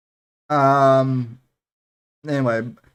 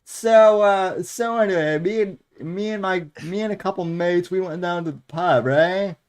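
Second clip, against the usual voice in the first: higher-pitched mock South London accent; discount British impression; 4 semitones higher than usual. Mimicking the co-host's bad impression, higher mock British accent. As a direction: parody a cut-price British voice. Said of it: "you're doing discount porcelain where it's like".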